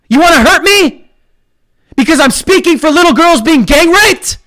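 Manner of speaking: baiting taunt at online critics. Real, not acted. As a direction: goad them, daring them to react.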